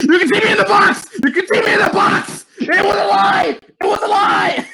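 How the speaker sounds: high panicked laughing shout; box over head, shouting. Real, not acted. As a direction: yell it, caught out. Realizes he's seen.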